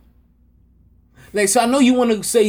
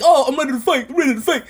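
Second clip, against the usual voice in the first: slightly raised, imitated begging; mock pleading; 4 semitones higher, faster than usual. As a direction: imitate someone pleading for a chance. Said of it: "begging and pleading, just, just like".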